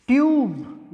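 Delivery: ट्यूब with a y glide then a long u; model pronunciation. Shown as a demonstration. Teacher to students, modelling the long u. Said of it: "य और उसके बाद में बड़ा ऊ sound आ रही है".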